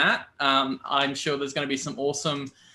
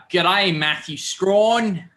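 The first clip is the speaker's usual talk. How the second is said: slow, drawn-out mock Aussie g'day; a slow, hammed-up Aussie greeting, louder and a bit higher; terrible Australian accent; 5 semitones higher, 4 dB louder, much slower than usual. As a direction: ham up a bad Australian accent. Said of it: "That was my terrible Australian accent".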